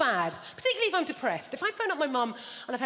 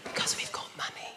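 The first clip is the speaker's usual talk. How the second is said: hushed, breathy whisper; 6 dB quieter than usual. Acted. Posh Brits whispering about their money. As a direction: whisper it like a guilty secret. Quiet middle class joke.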